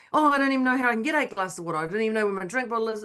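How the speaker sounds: rattled off fast in a mild fluster; flustered retold excuse. Coach mimics client fretting about water, flustered and fast. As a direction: rush the excuses out, imitating a client.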